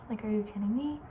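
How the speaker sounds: ordinary talk, nothing marked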